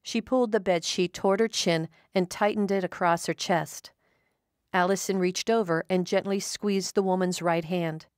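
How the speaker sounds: ordinary delivery, nothing marked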